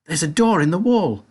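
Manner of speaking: put-on an excited boy pointing something out; cried out. A narrator's reading. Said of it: "cried Charlie".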